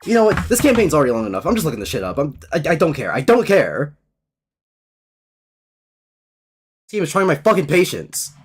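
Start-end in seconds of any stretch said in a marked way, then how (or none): 2.2-8.3 fed up. Real, not acted. Fed up with the game.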